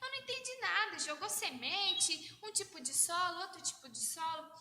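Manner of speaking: high, puzzled questioning voice; confused disciple voice. Acted. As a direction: ask like a baffled follower. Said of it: "vieram perguntar, falaram".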